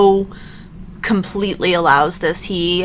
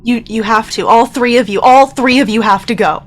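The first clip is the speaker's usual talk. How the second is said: higher, louder pleading; urgent insistence; 4 semitones higher, 4 dB louder than usual. Acted. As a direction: press your friends to flee without you. Bo urges friends to flee.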